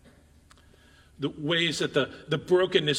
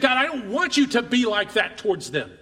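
higher, heated protest to God; acted angry objection; lashing out at God; 4 semitones higher, 4 dB louder than usual. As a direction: blame God in a raised voice. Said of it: "lashed out in anger".

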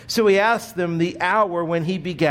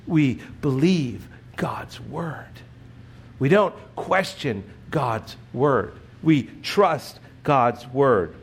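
slow emphatic; 3 semitones lower, slower than usual. Real, not acted. Slow emphatic creed statements.

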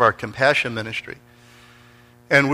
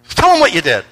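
higher, louder urging; insistent retold prodding; 7 semitones higher, 6 dB louder than usual. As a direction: press her to tell, voice raised. Retold repeated, insistent prompting.

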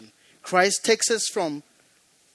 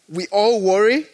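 louder, rising emphasis; 4 semitones higher, 8 dB louder than usual. Shown as a demonstration. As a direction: WORRY pushed loud and rising.

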